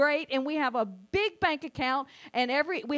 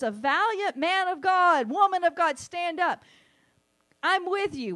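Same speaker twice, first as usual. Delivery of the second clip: proclaiming prophetic word; 5 semitones higher, 5 dB louder than usual. Retold or imitated. Quoting prophetic words to believers.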